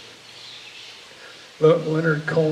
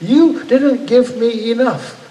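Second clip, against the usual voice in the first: higher, louder, slow furious accusation; retold raw fury; 7 semitones higher, 8 dB louder, slower than usual. Re-enacting a dream where he rages at God, slow and loud. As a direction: throw the words at God in re-enacted rage. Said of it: "just raw fury".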